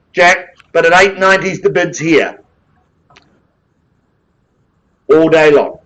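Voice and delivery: brisk auction patter, rising on calls; auctioneer calling bids. Real, not acted. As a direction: drive the room like a live auctioneer.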